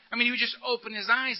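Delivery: usual speaking voice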